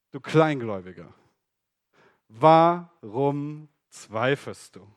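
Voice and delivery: Jesus rebuking Peter's doubt; gentle rebuke. Acted. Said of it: "spricht zu ihm".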